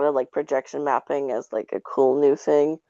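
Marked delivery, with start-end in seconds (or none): none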